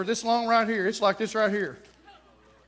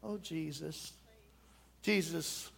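much softer, lower false adoration of the possessed man; mock fawning worship; 6 semitones lower, 13 dB quieter, slower than usual. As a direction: breathe "Jesus" in a hushed, fake-devout way. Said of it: "he comes up there, uh".

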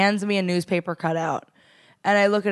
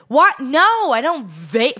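defensive; 5 semitones higher, 4 dB louder, much slower than usual. Retold or imitated. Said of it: "got really defensive".

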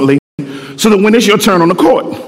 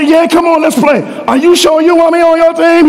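higher, eager invitation and a timid doubt; lightly voiced exchange; 9 semitones higher than usual. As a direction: coax the shy player. God invites, she doubts.

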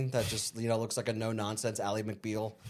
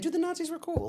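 much higher excited quote. Retold or imitated. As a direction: blurt it out, pitched up high. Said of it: "a clip of me saying".

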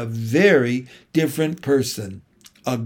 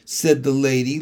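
ordinary delivery, nothing marked

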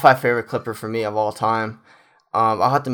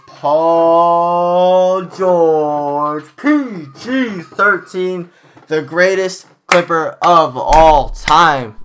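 very slow, loud, high announcer call; arena announcer intro; 7 semitones higher, 9 dB louder, much slower than usual. Acted. Fan presenting the greatest Clipper ever, long drawn-out announcer call. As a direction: boom it out like the player is taking the court.

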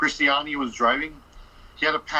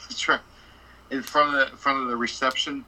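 ordinary delivery

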